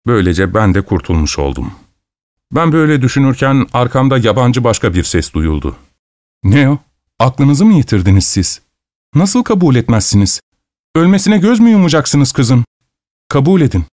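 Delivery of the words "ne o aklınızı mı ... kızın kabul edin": high, urgent scolding; outraged newcomer urging them to relent; indignant reproach. A narrator's reading. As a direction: plead and scold at once, pitched high. Newcomer reproaches family, another voice announced.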